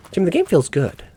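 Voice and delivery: usual speaking voice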